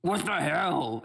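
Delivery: high, loud, drawn-out indignation; startled orc outburst. Acted. Orc to the stranger who ran into him, indignant outburst. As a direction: burst out slowly in affronted shock. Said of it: "he kind of like".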